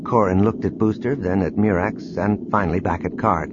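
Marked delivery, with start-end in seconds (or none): none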